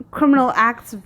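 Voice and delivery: normal talking voice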